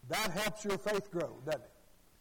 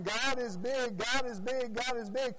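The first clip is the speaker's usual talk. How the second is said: higher, repetitive preacher chant; imitated excited preacher; 6 semitones higher, 4 dB louder than usual. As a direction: mimic a worked-up preacher repeating his slogan. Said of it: "he just kept saying".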